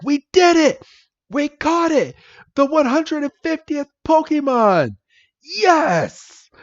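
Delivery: very high-pitched hammed-up cheering; triumphant celebration. Red catches the last Pokemon, jubilant mock cheer. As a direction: celebrate like an overjoyed anime hero.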